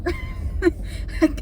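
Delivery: amused laugh at herself. Real, not acted. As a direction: laugh off your own clumsiness. Laughs at own bad multitasking.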